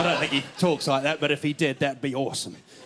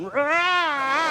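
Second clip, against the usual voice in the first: high startled yelp; mock scared yelp; 8 semitones higher than usual. Cornered on the bed by his date, mock yelp.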